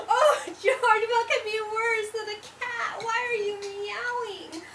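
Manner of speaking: high wail, starting loud; dismayed mother dog. A narrator's reading. Mama dog reacts to George meowing, loudly dismayed. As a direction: wail in exasperation at your pup.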